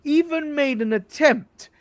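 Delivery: mock scolding. Scolding Kenny for never trying to win the title, slow and loud. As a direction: drag out the accusation in disbelief. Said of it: "scolding Kenny, building".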